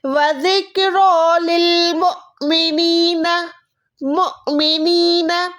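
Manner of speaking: मुमिनीन chanted slowly with a glottal catch; with a jerk on hamza. Shown as a demonstration. Teacher recites the correct reading, a jerk on the hamza. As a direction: give the hamza a sharp stop as you chant. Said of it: "इसको हम कैसे पढ़ेंगे? झटका देके".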